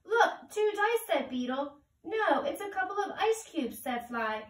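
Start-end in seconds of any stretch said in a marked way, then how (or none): 0.1-1.1 Beetle voice. Acted. 2.0-3.8 Fly voice. Acted.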